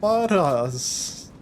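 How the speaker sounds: slow, high-pitched mock-Swedish accent; stretched-out imitation Swedish accent. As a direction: echo it in a drawn-out Swedish accent. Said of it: "with a Swedish accent".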